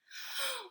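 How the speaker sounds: sharp, high startled gasp. Acted. Possum startled by the robot, high gasp. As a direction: gasp in fright. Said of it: "the possum gasped".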